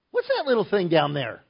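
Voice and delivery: mock puzzlement from high above; God peering down. God looks down at the tiny tower, lightly acted puzzlement. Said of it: "God's up there going".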